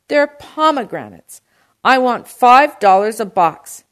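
very loud, high, insistent shout. A narrator's reading. Uncle insists on his price, shouting. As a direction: shout your demand, leaning on the words. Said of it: "my uncle shouted".

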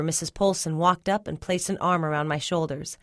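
usual speaking voice

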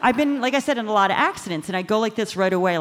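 ordinary talk

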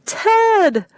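high, loud shrieked name. Acted. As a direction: shriek into the phone. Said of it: "Roxy shrieked into the phone".

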